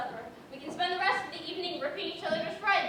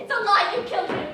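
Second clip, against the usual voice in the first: loud, desperate accusing scream; loud anguished accusation; 3 semitones higher, 9 dB louder than usual. Acted. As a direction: fling it back at him.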